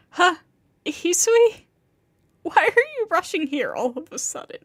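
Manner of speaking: high, loud start of surprise; truly surprised. A narrator's reading. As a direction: ask it caught off guard. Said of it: "I say that, truly surprised".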